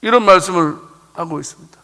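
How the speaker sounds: normal talking voice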